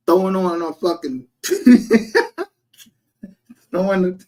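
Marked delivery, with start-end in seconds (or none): 1.4-2.4 high, sudden laugh breaking out; a quick high laugh; burst of laughter. Real, not acted. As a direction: crack up mid-sentence. Laughing mid-story about the towel.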